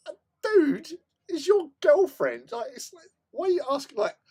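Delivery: incredulous. Retold or imitated. Exasperated retort to friend.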